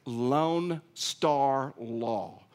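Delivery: very slow, drawn-out Texas twang on Lone Star Law; Texas drawl. Shown as a demonstration. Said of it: "I'll try to pronounce it".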